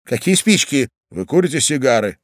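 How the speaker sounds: plain reading voice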